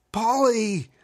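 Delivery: high, loud frantic yell of Polly; frantic cry. Acted. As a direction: scream his son's name. Father cries out.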